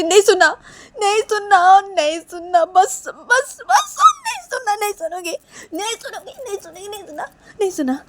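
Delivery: high-pitched overwrought wailing; frantic refusal. Acted. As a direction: shut them out, repeating it in rising hysteria. Neha covers ears, refuses to listen. (बौखलाकर चीखते हुए इनकार)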